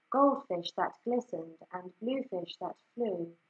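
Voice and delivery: ordinary delivery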